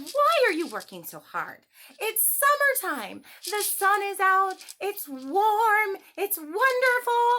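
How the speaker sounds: high, loud carefree grasshopper voice; playful grasshopper. Acted. Grasshopper taunting the busy ants, high and loud. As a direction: tease the hard workers from up high. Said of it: "He said".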